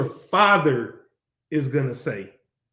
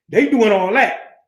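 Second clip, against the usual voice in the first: ordinary talk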